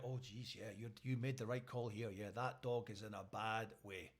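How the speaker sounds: low, quiet official verdict; understated low-voiced officer; animal welfare officer. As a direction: deliver it low and soft, like a sober assessment. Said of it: "Animal welfare come along and they're like".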